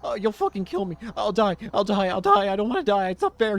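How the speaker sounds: high, loud, frantic pleading for his life; a terrified young man screaming for mercy; panicked begging. Acted. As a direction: beg like you're about to be killed.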